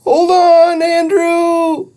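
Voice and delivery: high, loud scream. Acted. Judy screams to Andrew as they fall. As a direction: yell it in panic. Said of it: "screamed Judy".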